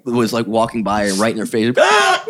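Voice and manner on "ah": loud high squeal of a hiccup; imitated hiccup. Hiccuping right in a passerby's face, squealed hiccup. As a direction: burst out with a yelped hiccup.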